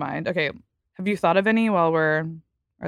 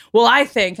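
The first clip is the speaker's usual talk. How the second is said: mansplaining guy; 5 semitones higher, 7 dB louder than usual. Retold or imitated. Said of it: "men will be like".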